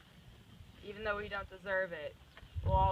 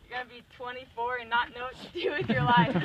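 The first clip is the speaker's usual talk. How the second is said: laughing through the words, louder; 4 semitones higher, 10 dB louder than usual. Real, not acted. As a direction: let real laughter break into the line. Laughing at her own life.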